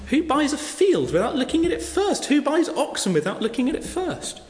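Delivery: high, disbelieving rhetorical questions; incredulous. Real, not acted. Preacher mocking the guests' excuses in disbelief. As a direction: scoff at the absurd excuses.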